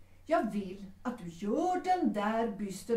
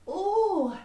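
delighted gasp; 10 semitones higher, 8 dB louder than usual. Acted. Receiving a beautiful bouquet.

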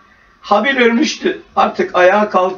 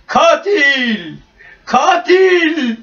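trees shouting; 7 semitones higher, much slower than usual. A narrator's reading. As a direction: call it out like swaying trees condemning him. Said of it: "diye bağırıyordu".

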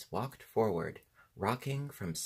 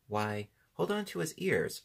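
a hesitant, higher-pitched suggestion; hesitant reply; 9 semitones higher than usual. A narrator's reading. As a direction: draw out "Why", pause, then suggest it. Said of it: "after a moment's hesitation".

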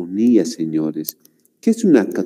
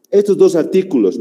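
normal talking voice

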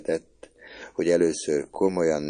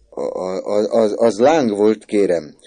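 stammering, loud and higher; flustered; 5 semitones higher, 10 dB louder, slower than usual. A narrator's reading. Confused boy explaining himself to the woman, flustered. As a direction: trip over the first words, then blurt it out. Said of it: "zavarba magyarázva".

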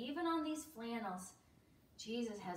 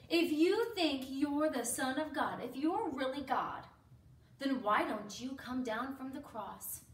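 loud, taunting challenge; crowd's scornful, emphatic dare; mocking; 3 semitones higher, 9 dB louder than usual. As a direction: jeer at him to prove himself. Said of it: "continued to mock him and make fun of him".

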